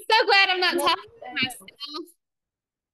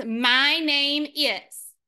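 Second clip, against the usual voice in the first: my name is drawn out slowly word by word; slow model phrase; much slower than usual. Shown as a demonstration. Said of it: "This is".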